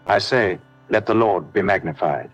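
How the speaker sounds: ordinary talk, nothing marked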